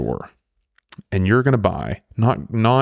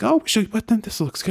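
mock shopper; 11 semitones higher than usual. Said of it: "and you're like".

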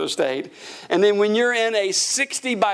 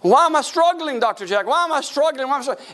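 high-pitched pleading question; mock plaintive asking; imitated whining complaint; 8 semitones higher, faster than usual. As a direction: mimic a struggling congregant, voice raised. Imitates a congregant's repeated complaint.

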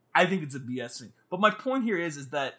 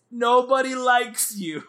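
slow, raised, louder quote; retold cutting remark; 6 semitones higher, 6 dB louder, slower than usual. Mowgli's last words to Boot, hammed-up hurt. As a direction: draw out the hurtful line, mock-dramatic. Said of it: "The last words Mowgli says to him".

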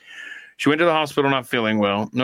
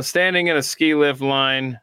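normal talking voice

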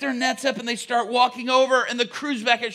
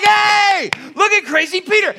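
higher, louder mocking jeer; loud jeering taunt; 8 semitones higher, 8 dB louder than usual. Shore crews mocking crazy Peter, loud jeer. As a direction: jeer at him for the whole beach to hear.

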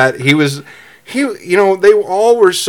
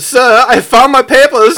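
high, loud, slow mock German accent; hammed-up German accent, voice pitched way up; 11 semitones higher, 6 dB louder, slower than usual. Driver hails the officer, hammed-up German accent. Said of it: "Or a German accent".

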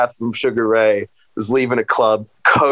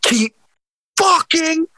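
much higher, very slow, crying shout; mock remix yell; much slower than usual. Host imitates the remixed Oscars yell, wailing and slow. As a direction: drag it out in a wailing mock holler. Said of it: "he yells up to him".

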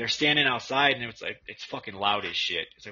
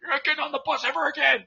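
retold shouting; 4 dB louder than usual. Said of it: "he's like".

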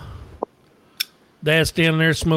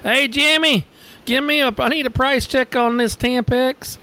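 page the stockboy over the store speaker, much higher; cashier on the PA; 10 semitones higher than usual. Retold or imitated. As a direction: checkout lady calling for a price check over the mic. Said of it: "the lady gets on the microphone".

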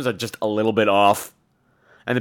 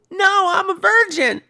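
very high, breathy falsetto giggle; 5 dB louder, slower than usual. Retold or imitated. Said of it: "sounds like [name]".

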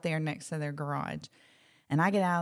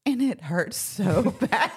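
"so" stretched out and louder, with a laugh; emphatic pain; 2 semitones higher, 5 dB louder, slower than usual. Real, not acted. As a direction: lean hard and slowly on "so", laughing through "bad". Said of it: "I just can't emphasize how bad this hurts".

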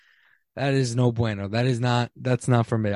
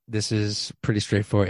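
ordinary talk

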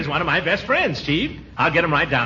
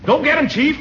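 nervous; 9 semitones higher than usual. Acted. Scared, hanging back.

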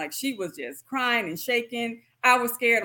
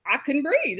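ordinary talk, nothing marked

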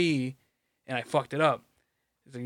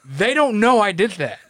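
louder, higher, laughing protest; laughing defence; 5 semitones higher, 5 dB louder, slower than usual. Real, not acted. Caught out by his friend, laughing defensive protest. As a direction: fight back through a laugh.

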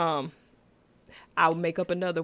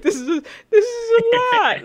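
mock teary; 8 dB louder than usual. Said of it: "I was like".

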